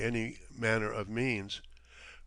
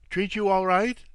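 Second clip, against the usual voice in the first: loud, high, blunt question; abrupt demand; 7 semitones higher, 7 dB louder than usual. A narrator's reading. Captain demands an answer of his guest, abruptly. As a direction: fire the question at him. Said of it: "he demanded abruptly".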